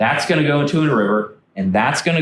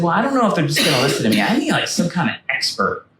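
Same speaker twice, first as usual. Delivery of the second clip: voicing Colvin; 6 semitones higher, faster than usual. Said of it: "He said".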